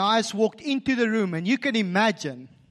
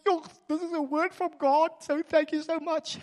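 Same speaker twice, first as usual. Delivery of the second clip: tearful thanks; 10 semitones higher than usual. Retold or imitated. Crying person thanks the prayer.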